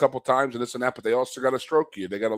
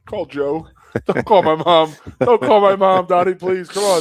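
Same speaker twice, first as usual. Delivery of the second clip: louder desperate begging, pitched up; panicked pleading; 8 semitones higher, 10 dB louder than usual. Retold or imitated. Scared player begging the coach, loud and pleading. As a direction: beg him not to call mom. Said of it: "I'd be like".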